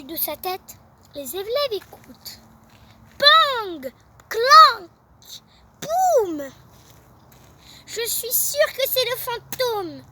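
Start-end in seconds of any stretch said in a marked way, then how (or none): 3.2-6.5 punchy loud noise words; loud bangs. Acted. Noises overhead, banging loudly. 7.9-10.0 moaning. Acted. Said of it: "gémit Nour".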